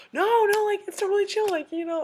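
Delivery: high, loud, emphatic brush-off; imitating someone insisting it's fine; insistent reassurance. Actor reassuring a gushing fan.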